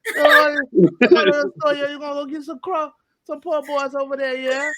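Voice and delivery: put-on teasing the wife's family with a New Orleans accent; imitating New Orleans in-laws. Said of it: "they go".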